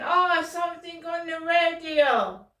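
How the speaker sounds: hammed-up offhand yell; mock shout. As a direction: shout it back like the guy in the car. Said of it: "And he went".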